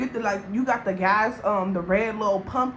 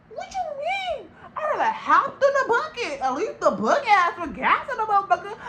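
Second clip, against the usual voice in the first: very high, squeaky, laughing through the words; laughing disbelief; 11 semitones higher than usual. Retold or imitated. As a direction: crack up while still mocking him. Mock outrage dissolving into laughter.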